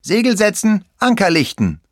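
high, loud captain's command; little pirate bellowing to the crew; calling orders. A narrator's reading. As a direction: call the orders out across the deck. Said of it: "ruft er dann".

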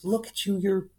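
wife's voice. Retold or imitated. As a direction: play the wife, high and scolding about the tiny skirt. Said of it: "And she goes".

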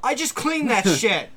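loud, laughing yell; wife yelling at the men ransacking her house. Acted. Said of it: "I yell".

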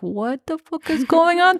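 hammed-up confusion; shocked character voice. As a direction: sound suddenly baffled and startled. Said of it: "she's like".

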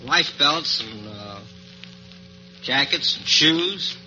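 ordinary delivery

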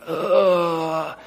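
a pained, hammed-up groan; mock anguished groan. Pastor reliving ministry loss, mock pained groan. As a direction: groan like something precious was cut. Lamenting God's pruning of ministry.

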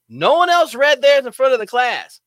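loud, high-pitched protest; mock indignation. Teller griping about being singled out in class, loudly indignant.

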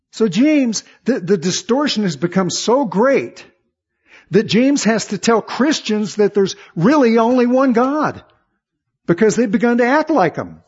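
loud, high, driving preacher's cry; preaching at full force. Real, not acted. Preacher stirring up the church, loud and high. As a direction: lift it and press the point hard.

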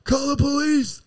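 high-pitched mock yell for help; retold yelling. Addict up a stoplight pole fleeing dogs, yelling. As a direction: cry out high, drawing out the words. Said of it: "and be yelling".

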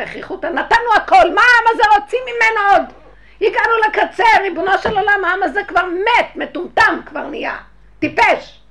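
loud, high impassioned preaching; a preacher crying out to the heavens in anger; fiery preaching. Acted. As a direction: thunder at the people. Preaching at full force.

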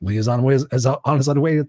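halting, botched "on his way"; stumbling false start on "is on his way"; tongue-tied stumble. Real, not acted. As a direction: trip over "on his way", dropping "his" and restarting. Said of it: "Sorry, I could say that ten times fast".

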